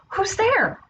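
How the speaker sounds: high, startled call; startled question. Acted. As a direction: ask the unknown noise who it is. Woken wolf calls to the bushes.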